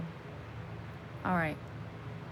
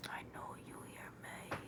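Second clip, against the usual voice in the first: whispering; 18 dB quieter than usual. Real, not acted. Whispering to sleeping partner.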